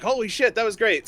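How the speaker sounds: high, thrilled exclamation; retold excitement. Recalling a childhood catch, high-pitched excitement. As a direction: relive a kid's amazement.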